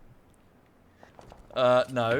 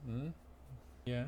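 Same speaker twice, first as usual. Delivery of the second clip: cooing to dog; 5 semitones lower, 13 dB quieter than usual. Real, not acted. Owner coos softly to her dog, offering cuddles. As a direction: coo softly like greeting a puppy.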